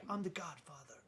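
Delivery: hushed attempt at the Godfather. Uncle announcing his new title, tentative Godfather impression. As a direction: quietly try out a mobster godfather voice. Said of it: "How does he do it?".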